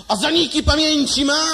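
high, loud barking doctor; rude doctor. Acted. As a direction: snap at the patient like a grumpy doctor. (opryskliwy lekarz, wysoko i głośno)

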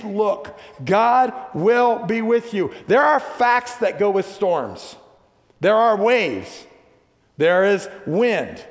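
high, emphatic preacher's voice; full-force preaching. Real, not acted. Preacher warning the congregation of coming storms, forceful and high. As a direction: preach it loud and high, driving each phrase.

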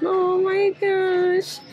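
gushing. Real, not acted. Gushing over cute baby clothes.

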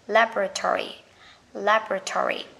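American "Laboratory", LAB-ra-tory; American pronunciation. Shown as a demonstration. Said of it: "Người Mỹ sẽ đọc là".

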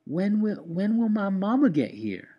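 put-on high, pleading little boy; small child voice. A narrator's reading. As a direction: ask like a worried small child. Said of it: "Benjamin asked for the hundredth time".

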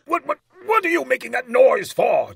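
high, loud spluttering king; furious demand. A narrator's reading. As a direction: splutter in fury at the dwarf. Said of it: "turning furiously to the dwarf".

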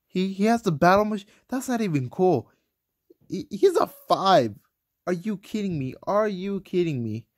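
outraged at an unfair matchup; indignant complaint. Real, not acted. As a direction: gamer whining at the enemy base. Complains about enemy defenses.

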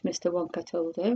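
usual speaking voice

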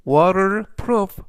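"waterproof" drawn out slowly and clearly; slow model reading. Shown as a demonstration. Teacher reading the example sentence, slow and clear. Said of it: "활용 문장 보시겠습니다".

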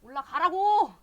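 high, loud angry yell at the character; angry shout. Real, not acted. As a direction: scream the order in frustration. Yelling at game character. (화나서 높고 크게 "올라가라고!" 고함)

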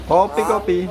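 high hawker's cry; coffee seller's call. Shown as a demonstration. Owner teaches bird the coffee cry, high.

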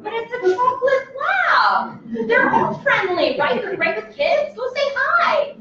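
high, slow, breezy mock reassurance; mock careless owner. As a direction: play the naive owner brushing off danger. Mocking careless dog owners.

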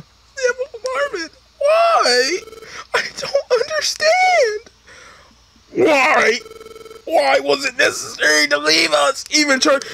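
high, sobbing partner voice; hammed-up tearful high cry. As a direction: wail it through mock tears. Voices partner crying at farewell.

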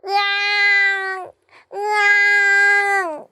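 high, drawn-out mock baby wail; baby crying. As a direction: imitate a wailing baby. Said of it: "아기가 우는 소리가". (아기 울음소리 흉내)

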